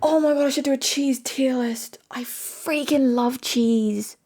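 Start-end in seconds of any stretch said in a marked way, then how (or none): 2.1-4.2 slow, stretched gush. Real, not acted. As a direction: drag it out lovingly. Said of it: "drawn-out gush".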